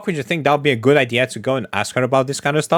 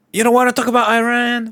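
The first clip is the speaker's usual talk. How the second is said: higher, whiny fan voice; mock disappointed fan; 10 semitones higher than usual. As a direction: sneer it like an offended viewer.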